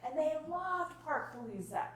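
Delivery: ordinary talk, nothing marked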